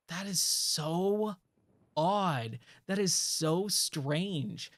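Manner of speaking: slow, drawn-out puzzlement; mulling it over slowly, word by word; baffled disbelief. Retold or imitated. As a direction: stretch out each word in retold bafflement. Reacting to the trainer vanishing.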